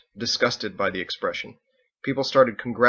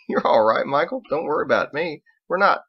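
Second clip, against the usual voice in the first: laughing reassurance; 6 semitones higher, 6 dB louder than usual. Acted. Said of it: "Biggs laughed".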